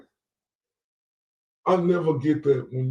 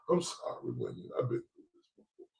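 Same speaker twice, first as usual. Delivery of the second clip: ordinary delivery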